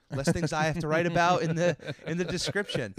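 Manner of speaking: loud joking. Real, not acted. Joking relief, no plugs.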